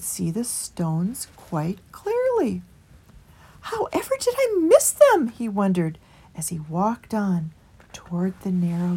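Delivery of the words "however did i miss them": higher mild voice of a small creature; meek small creature pleading for the way; Christopher. A narrator's reading. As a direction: lift into Christopher's gentle, higher voice. Christopher wonders.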